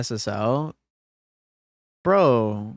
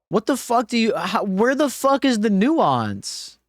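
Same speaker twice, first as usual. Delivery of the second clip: incredulous, scolding the chatter; indignant outburst; 6 semitones higher than usual. Real, not acted. Streamer to chat about nuance, incredulous outrage. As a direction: pitch jumps up, the fuck leaned on hard.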